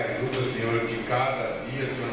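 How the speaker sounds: normal talking voice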